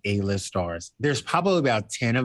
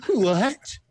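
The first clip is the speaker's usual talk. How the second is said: high, gasped what; imitating a friend's shocked gasp; mock shocked what; 9 semitones higher than usual. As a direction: friend Roger's shocked reaction, much higher. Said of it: "he was like, gasps".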